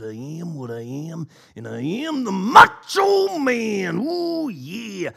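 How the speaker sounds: gravel-free but wild, swooping wrestler promo voice with big whoops; Macho Man Randy Savage. Retold or imitated. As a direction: ham it up like a 1980s wrestler cutting a promo. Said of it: "talk in any other voice the entire night, other than the Macho Man voice".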